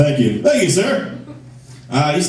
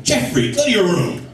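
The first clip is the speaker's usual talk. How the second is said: high, drawn-out parental order; scolding parent; 9 semitones higher than usual. Acted. Parent orders Jeffrey to his room, scolding. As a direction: send the kid to his room, slowly and high.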